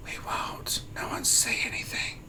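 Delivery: hushed, voiceless secret-keeping; conspiratorial whisper. Pretend. Streamer covering for a viewer skipping work, conspiratorial whisper.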